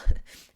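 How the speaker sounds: loud, short teary laugh; a burst of laughing through tears; tearful laugh. Real, not acted. Vlogger embracing her vulnerability, short teary laugh. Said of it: "And I don't care".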